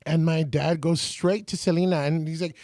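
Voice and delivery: ordinary delivery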